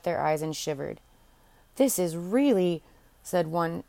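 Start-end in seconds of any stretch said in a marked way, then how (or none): 1.8-2.8 mildly scandalized, drawn out with a swoop on "really"; slow, appalled understatement; shocked courtier. A narrator's reading. Courtiers react to Ursula kissing the king, shocked.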